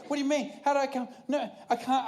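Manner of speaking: high, disbelieving protest; can't believe what you're hearing; incredulous. Retold or imitated. Runner hearing he was lapped, high incredulous protest.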